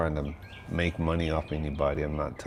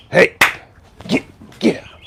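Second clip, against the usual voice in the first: sudden high loud shout; shooing squirrels; 13 semitones higher, 12 dB louder than usual. Real, not acted. He chases squirrels off his house, shouting. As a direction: yell to scare off a critter.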